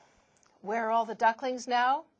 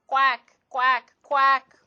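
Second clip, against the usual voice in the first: louder, duck-like quack, quack, quack; mock quacking; 7 dB louder, slower than usual. Mama shows ducklings how to quack.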